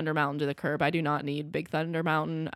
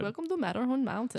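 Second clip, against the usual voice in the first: higher, soft imitation of the Matterhorn ride accent; amateur Matterhorn announcer accent, pitched up; 6 semitones higher, 5 dB quieter than usual. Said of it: "You do it way better than me".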